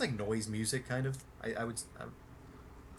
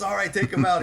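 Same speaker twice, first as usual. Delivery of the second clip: giving the order through laughter, higher and louder; laughing command; 7 semitones higher, 9 dB louder than usual. Retold or imitated. Billy clearing fans off the bus, laughing. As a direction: chuckle it out.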